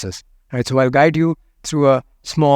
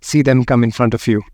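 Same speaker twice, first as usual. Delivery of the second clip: guided meditation. Acted. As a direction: invite them to feel it, unhurried.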